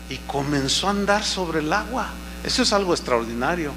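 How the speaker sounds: ordinary talk, nothing marked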